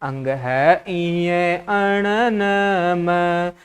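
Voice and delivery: अंग है इये अण न म, each nasal letter slowly drawn out; correct pronunciation demo. Shown as a demonstration. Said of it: "इनका जो शुद्ध उच्चारण होगा". (अंग है इये अण न म का धीमा, खींचा हुआ शुद्ध उच्चारण)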